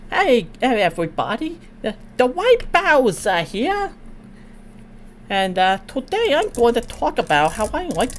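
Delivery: high retired Elmer Fudd voice. Retold or imitated. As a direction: talk like an old Elmer Fudd. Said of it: "That's what he sounds like".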